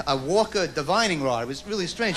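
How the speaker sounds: ordinary talk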